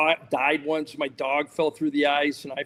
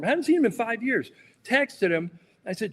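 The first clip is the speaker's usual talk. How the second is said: ordinary talk, nothing marked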